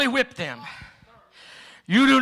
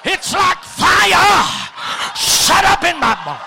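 preaching at full force; 11 semitones higher, faster than usual. Real, not acted. Preacher urging preachers to tell each other, shouting. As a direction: fire up the crowd.

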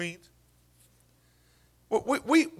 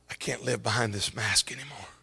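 soft whisper, much quieter; whispered confession; 6 semitones lower, 6 dB quieter than usual. Acted. Father confessing quietly that he can't hide anymore. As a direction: breathe the words out in a hush.

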